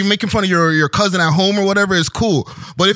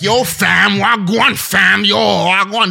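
mock Toronto slang; much slower than usual. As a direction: mimic a wannabe Toronto man. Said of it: "Freaking Napanee is like".

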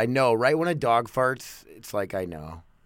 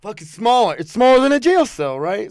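exasperated; 9 semitones higher, 8 dB louder than usual. Real, not acted. Exasperated about tiny room.

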